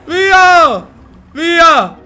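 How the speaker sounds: high, loud, drawn-out shouts to get away; loud shouting. Real, not acted. Gamer shouting to teammate Maria to escape. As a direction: shout to flee.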